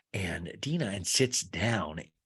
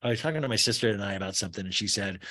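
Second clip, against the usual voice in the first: usual speaking voice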